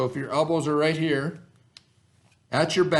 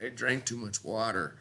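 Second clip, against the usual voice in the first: quiet, mock-queasy groan after drinking too much; 4 semitones lower, 11 dB quieter than usual. Teacher voicing a kid who drank too much, quiet mock groan. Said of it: "you're like".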